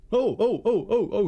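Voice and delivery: screaming trucks. A narrator's reading. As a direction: yelp like startled trucks. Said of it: "screamed the trucks".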